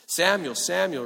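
calling out. Acted. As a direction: call him from afar, insistent. The Lord calling Samuel.

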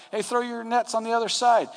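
high-pitched retold holler; calling from shore. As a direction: call instructions out to a boat. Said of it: "Jesus yells out from the shore".

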